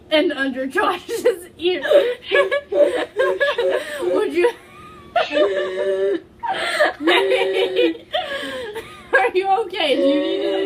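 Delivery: reading through helpless laughter; can't get the words out for laughing; laughing fit. Real, not acted. Reading fanfic to friends, breaking into real laughter.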